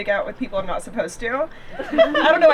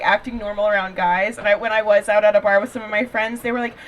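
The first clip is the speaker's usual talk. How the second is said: usual speaking voice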